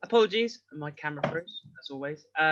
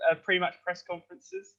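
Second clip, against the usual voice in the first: ordinary talk, nothing marked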